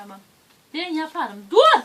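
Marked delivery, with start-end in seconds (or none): 1.5-1.8 sharp loud shout of stop. Real, not acted. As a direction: yell it to stop him. Stopping child from hitting foot.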